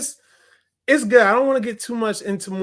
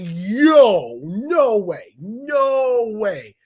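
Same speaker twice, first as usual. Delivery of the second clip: louder "no way" in stunned disbelief; shocked disbelief; 5 dB louder, much slower than usual. Retold or imitated. Reacting to a shocking death, stunned and louder. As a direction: gasp it out like you can't believe it. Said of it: "a couple of deaths that I was like".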